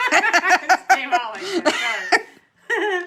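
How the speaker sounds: embarrassed giggle while explaining; laughing. Real, not acted. Cohost laughing at her own odd accent.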